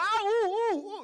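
very high, loud, straining "ooh ooh"; eager student. Retold or imitated. Kid bursting to answer the teacher, high loud ooh ooh. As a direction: pick me, pick me, hand waving. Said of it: "when you got the answer".

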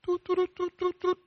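high toot toot imitating Morse beeps. Shown as a demonstration. Preacher imitating Morse code, very high beeps. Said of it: "The Morse code made sounds".

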